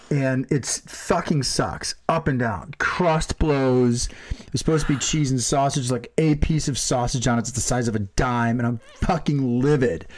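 usual speaking voice

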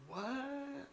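high, laughing baffled what; a squeaked disbelieving what. Retold or imitated. Telling friends about country neighbours, baffled squeak.

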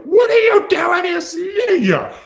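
high, loud mock yell at a cheating boyfriend; hammed-up high-pitched outburst; pretend shout. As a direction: shriek it like the jealous scene she skipped. The yell she chose not to do.

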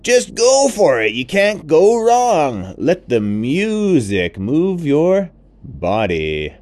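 high, loud, booming happy elephant; big cheerful elephant voice; jolly elephant. Acted. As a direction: boast about being noisy. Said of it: "the elephant said".

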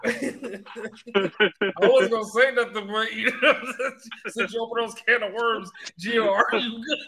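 high, laughing through it. Real, not acted. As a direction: tease while cracking up. Teasing friend through laughter.

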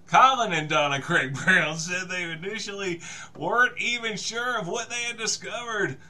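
ordinary talk, nothing marked

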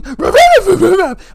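high yipping ruff ruff; high little yap; yappy dog bark. Retold or imitated. Imitating the dog's bark.

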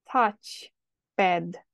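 touchpad said slowly as tacipad; careful pronunciation. Shown as a demonstration. Teacher showing students how to pronounce touchpad. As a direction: model the word touchpad clearly, like tacipad. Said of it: "Observați și pronunția".

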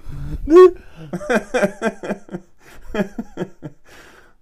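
long loud laughing fit; loud laughter. Real, not acted. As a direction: keep cracking up. Long laugh at own line.

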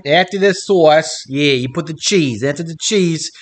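mock Italian with a Southern twang; badly faked Italian accent gone country. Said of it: "I heard the country now".

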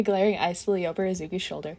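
plain reading voice

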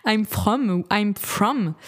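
slow, careful English accent on I'm From. Shown as a demonstration. Beauty vlogger testing an English accent on a brand name. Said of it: "je suis avec l'accent anglais".

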